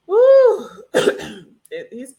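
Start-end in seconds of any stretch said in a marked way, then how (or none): 0.1-0.7 loud woo. Real, not acted. Reacting to the show.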